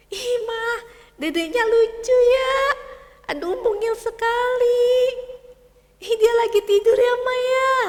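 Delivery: put-on high, loud child's delight; a boy gushing over his baby brother; delighted little boy. Michael senang lihat adik. (anak kecil senang sekali)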